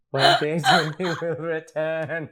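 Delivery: laughing through it. Acted. Laughing while repeating poster line.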